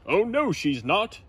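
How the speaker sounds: mild mock yell; knight yelling. A narrator's reading. Gadabout yelling back at Zog, mild yell. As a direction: argue back. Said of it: "yelled Gadabout".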